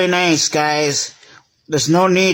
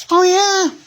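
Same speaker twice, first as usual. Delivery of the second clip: very high, squeaky "Oh, yeah?" dare; squeaky taunting toy; 14 semitones higher than usual. Pretend. One toy squeaking a dare at the train. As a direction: squeak it out like a cheeky little toy picking a fight. Toys quarrel in pretend play.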